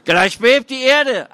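high, loud, slow taunt; slow mocking jeer. A narrator's reading. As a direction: drag out the taunt. Third heckler mocks.

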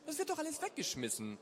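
high-pitched, irritated objection; unwilling. A narrator's reading. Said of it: "fragte sie unwillig".

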